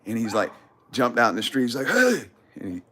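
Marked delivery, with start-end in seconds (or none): 1.9-2.2 high, loud imitated shout; aggressive yell. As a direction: yell it out like an angry dog walker. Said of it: "jumped at me aggressively".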